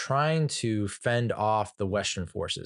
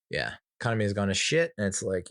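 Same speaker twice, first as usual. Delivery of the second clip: ordinary talk, nothing marked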